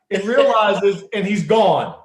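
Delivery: usual speaking voice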